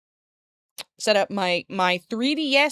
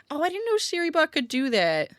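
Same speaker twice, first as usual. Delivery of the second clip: surprised; 8 semitones higher than usual. Real, not acted. Bot surprises her.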